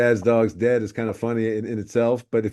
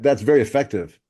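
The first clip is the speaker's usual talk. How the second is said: usual speaking voice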